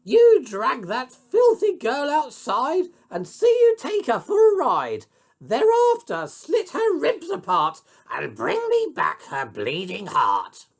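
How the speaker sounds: very high, loud commanding queen; angry shout. A narrator's reading. Queen ordering the huntsman, high furious shout. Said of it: "She shouted at him".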